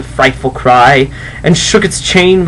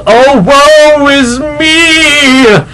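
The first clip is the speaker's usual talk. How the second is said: very slow, higher, louder lament; ghostly wail; 13 semitones higher, 7 dB louder, much slower than usual. Acted. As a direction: wail it like a doomed spirit. Ghost wails its doom.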